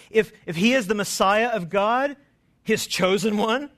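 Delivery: loud scornful challenge; mocking taunt. Preacher voicing rulers taunting the Messiah, loud mockery. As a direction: sneer the question at him. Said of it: "There's such mockery in this".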